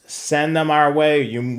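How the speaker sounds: normal talking voice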